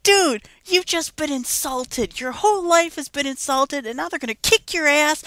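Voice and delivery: high-pitched, incredulous retold scolding; mock outrage on someone's behalf; exasperated urging. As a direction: goad a deadpan character into reacting. Retold scolding of an emotionless character.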